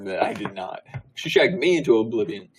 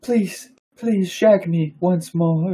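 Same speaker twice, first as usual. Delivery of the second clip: breathless lover; 6 semitones higher, much slower than usual. Pretend. Hamming up the lovers' scene.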